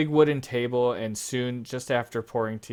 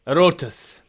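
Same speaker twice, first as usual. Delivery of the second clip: louder, higher call across the room; father calling his son over; 6 semitones higher, 9 dB louder than usual. Acted. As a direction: summon the boy firmly.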